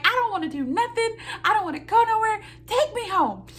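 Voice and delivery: high, loud, petulant complaint; wife refusing the Lowe's stop, high and whiny; whiny protest. Retold or imitated. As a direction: whine like a tired kid refusing errands.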